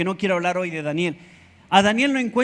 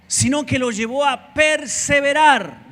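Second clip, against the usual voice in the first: rising sermon emphasis; 6 semitones higher, 3 dB louder than usual. Acted. Preacher builds to key word.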